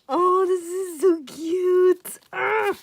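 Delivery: high, loud, drawn-out gush; overwhelmed squeal. Real, not acted. Fan overcome by cute artwork, overwhelmed squeal. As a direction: draw it out slowly in a delighted squeal.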